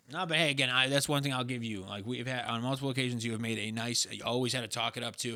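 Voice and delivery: normal talking voice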